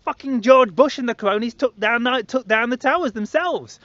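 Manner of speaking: high, eager rush of news; excited. Retold or imitated. Telling friends his discovery, high and excited. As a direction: burst out with the big discovery. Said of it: "I was, like, so excited to tell everyone".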